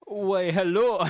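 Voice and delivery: slow, hammed-up seductive German greeting; bad German accent. Told to try again as Hitler, bad German accent. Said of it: "I can't do a German accent".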